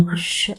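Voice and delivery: a hushed shh with finger to lips; soft shush. Acted. She silences Brahmi before in-laws hear, soft shush. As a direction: shush him softly, keep it barely audible. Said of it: "నోటి మీద వేలు పెట్టుకుని".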